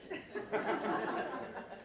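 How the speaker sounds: breathy burst of laughter; real laugh. Real, not acted. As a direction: laugh before starting the speech. Nervous opener after applause.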